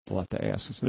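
ordinary delivery

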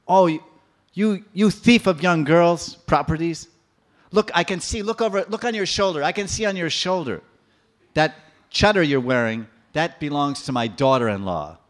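put-on old lady of Braj scolds Krishna; old woman accusing Krishna, angry; scolding old woman. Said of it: "She's becoming so angry".

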